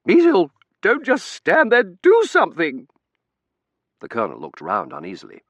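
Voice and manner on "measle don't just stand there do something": shrieking demand. Acted. Hysterical wife orders husband.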